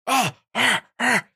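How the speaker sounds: high, sharp imitated chant of little kids; mock high chant of kids. Mocking Chinese kids training in class, in a high mock chant. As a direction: chant it up high like drilled schoolkids. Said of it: "They're like".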